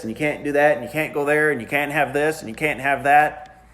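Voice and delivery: normal talking voice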